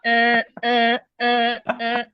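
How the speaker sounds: mock alarm. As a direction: sound a pretend alarm like a human siren. Jokingly sounds an alarm.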